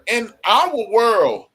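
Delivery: usual speaking voice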